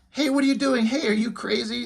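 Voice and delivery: bewildered zombie hailing his pal; zombie calling to buddy. Retold or imitated. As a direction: call out puzzled to a friend. Zombie shot at by crowd.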